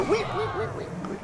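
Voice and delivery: high squeaky wee wee, fading soft; guinea pig squeal. Retold or imitated. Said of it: "they go".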